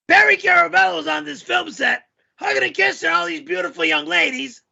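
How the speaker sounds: put-on yelling voice, pitched well up; yelling character voice. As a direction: tell it in a high, yelled character voice. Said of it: "the guy yells all the time".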